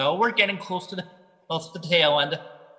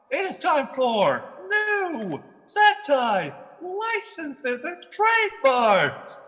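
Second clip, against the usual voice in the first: high, slow announcer build-up; game show announcer; 7 semitones higher, much slower than usual. Pretend. Repeats announcement, bigger.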